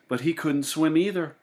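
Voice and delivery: ordinary delivery, nothing marked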